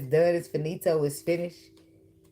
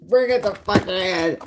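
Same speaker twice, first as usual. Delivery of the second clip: slow, louder, mock-bossy demand; playful bossy order to hug, louder; insistent hug demand; 3 semitones higher, 6 dB louder, slower than usual.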